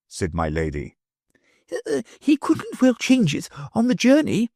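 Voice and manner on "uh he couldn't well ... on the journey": meek. A narrator's reading. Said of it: "the professor meekly replied".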